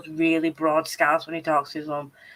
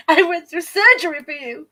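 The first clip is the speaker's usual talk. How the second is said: high, loud comic plea; mock desperate shout; 10 semitones higher, 7 dB louder than usual. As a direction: shout your grievance, half laughing. Mock pleading to be let in.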